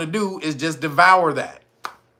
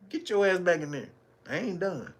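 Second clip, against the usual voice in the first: soft mock order; 9 dB quieter than usual. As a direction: drop the voice to a hush-like command. Acted man, now soft.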